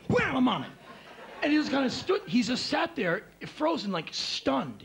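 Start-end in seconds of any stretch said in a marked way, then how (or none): 0.1-0.7 cocky reflex. Retold or imitated. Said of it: "Ken was like".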